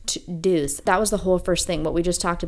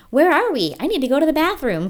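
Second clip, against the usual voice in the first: high, louder whiny helpless voice; whiny lost Charlie; 9 semitones higher, 5 dB louder than usual. Pretend. Cohost voices Charlie as the clueless one, high whining. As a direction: whine like a scared kid on the island. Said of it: "kind of character".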